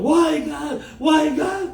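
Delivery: high mock shout to God; imitated complaining cry. Preacher voicing believers hit by hardship, mock anguished shout. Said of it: "Kita bilang".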